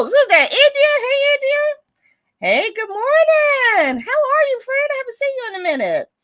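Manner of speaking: excited greeting. Real, not acted. Greeting a friend arriving.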